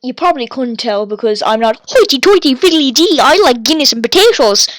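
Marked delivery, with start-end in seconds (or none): 1.9-4.7 hammed-up Irish lilt, pitch swooping up and down, louder; mock Irish accent. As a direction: do a loud, singsong stage-Irish brogue. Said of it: "That's not my accent".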